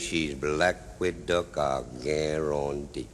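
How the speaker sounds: slow, sinking low and soft; drawn-out catchphrase. Acted. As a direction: let the line settle slowly down to a deep close. Drawn-out catchphrase capping a description.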